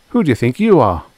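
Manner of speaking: put-on boy's forceful counter-question; retorting challenge. A narrator's reading. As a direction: throw the challenge back. Roddy throws the challenge back.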